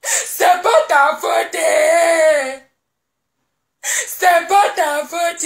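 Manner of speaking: slow drawn-out wailing sobs; mock crying. Wife pretends to weep over her husband: slow mock wailing. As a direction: stretch each word into a fake sob.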